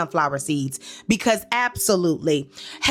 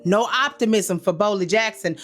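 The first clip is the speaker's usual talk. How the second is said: ordinary delivery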